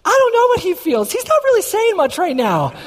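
very high-pitched, gushing phone voice; imitated excited wife. Wife telling the in-laws the news, mock excited. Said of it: "She's on the phone talking to my in-laws".

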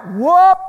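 high, loud whoop effect; whoop sound. Retold or imitated. Preacher makes Jesus's ascent sound with a loud whoop. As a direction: zip him upward with a whoop.

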